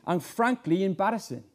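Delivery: normal talking voice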